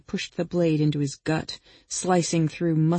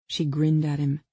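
plain reading voice

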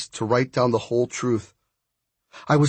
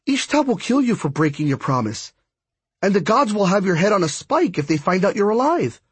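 urgent warning; 7 semitones higher than usual. Acted. Friend warns against the plan.

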